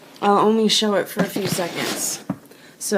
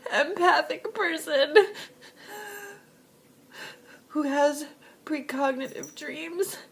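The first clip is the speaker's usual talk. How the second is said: tearful; 8 semitones higher, 3 dB quieter than usual. Real, not acted. Describing herself while crying.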